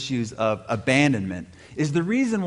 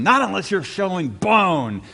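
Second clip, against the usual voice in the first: mock yell, pitched up; retold coach's yell; 5 semitones higher, 3 dB louder than usual. Football coach refusing to bench a hurt linebacker, mock yell. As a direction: imitate a coach yelling at a player. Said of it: "yells at him".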